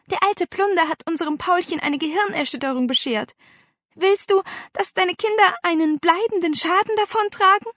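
high, urgent appeal. A narrator's reading. Aunt pressing the uncle about the children's safety, urgently. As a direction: press the question with worried insistence. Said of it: "fragte leise und eindringlich".

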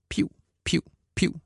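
squeaky high wheeze from the chest; breathless chest squeak; high wheezing piv. A narrator's reading. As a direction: wheeze it out high and thin like a tiny whistle. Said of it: "siger det fra hans bryst".

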